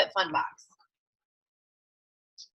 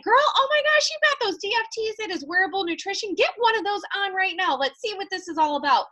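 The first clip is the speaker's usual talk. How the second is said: put-on much higher, fast, squealy hype girl; Kayla Fox gushing; 8 semitones higher than usual. Mentor imitated cheering a recruit to try the stickers, excited. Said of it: "She's like".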